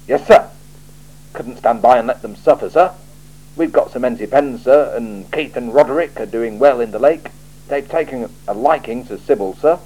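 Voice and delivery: loud, clipped soldier's answers; a watchman reporting stiffly to his boss; barked, dutiful replies; Commander Vimes. Acted.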